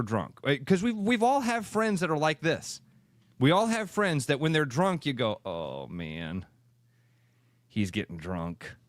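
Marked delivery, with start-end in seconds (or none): 5.4-8.8 a wary aside under the breath to a friend; low muttered warning. Retold or imitated. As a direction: mumble it low and soft, lower pitch. Friend warns about drunk buddy.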